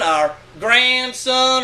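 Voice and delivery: ordinary delivery, nothing marked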